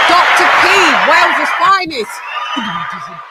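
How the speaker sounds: loud, high hype announcement; hype shout-out. Real, not acted. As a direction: call it out like a hype man. Hype roll call shout-outs.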